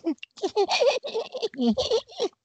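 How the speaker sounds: high chirpy dolphin chatter; chirpy clicking dolphin. Retold or imitated. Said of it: "That's my dolphin impression".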